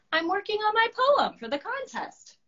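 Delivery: high earnest dragon explaining. Acted. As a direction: answer proudly as the dragon. Dragon answers Monster.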